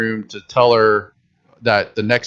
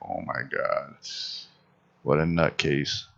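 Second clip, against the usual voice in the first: low, unvoiced disbelieving aside; muttered aside; 8 semitones lower, 12 dB quieter than usual. Reading the article about her, a low whispered aside. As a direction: breathe it out low under the breath. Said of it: "I was like".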